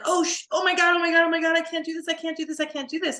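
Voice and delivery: high, loud panicked cry of a student in pain. Retold or imitated. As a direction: yelp it out like you can't hold the pose a second longer. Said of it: "And people are like".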